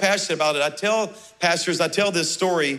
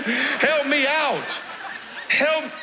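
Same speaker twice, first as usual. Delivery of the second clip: laughing plea; 7 semitones higher, much slower than usual. Retold or imitated. Pleading with the unhelpful man.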